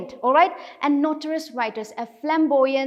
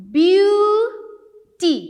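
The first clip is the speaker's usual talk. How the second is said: "beauty" as BEAU, long and loud, then TY; split into syllables; 7 semitones higher, 7 dB louder than usual. Shown as a demonstration. Teacher showing two syllables in a word, split apart loudly. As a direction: draw out and raise "beau", pause, then "ty". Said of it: "Two syllable here".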